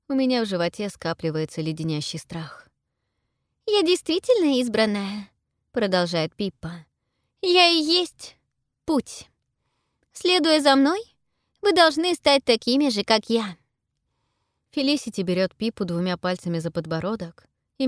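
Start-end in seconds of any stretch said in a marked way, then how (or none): 3.6-13.6 put-on high, exalted girl's voice; proud girl voice. A narrator's reading. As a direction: proclaim yourself the chosen one. Pippa's voice.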